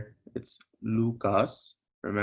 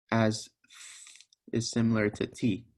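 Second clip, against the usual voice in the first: ordinary delivery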